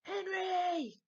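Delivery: huge loud bellow of a name. A narrator's reading. Dad bellowing at Henry from downstairs, full roar. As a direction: roar it up the stairs. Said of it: "bellowed Dad".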